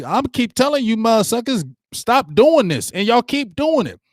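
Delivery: high-pitched, exasperated mock scolding; imitating mad coach. Coach telling players to stop repeating mistakes, retold as mad. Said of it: "He mad".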